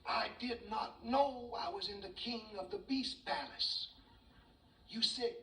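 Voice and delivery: high, drawn-out comic pleading; terrified mouse. Acted. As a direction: beg for mercy in a tiny panicked voice. Mouse begs the lion for mercy.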